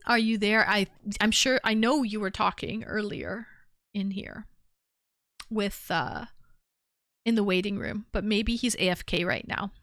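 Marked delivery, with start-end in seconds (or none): none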